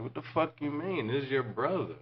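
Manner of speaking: high-pitched retold protest; acted indignant comeback. Acted argument in retold story.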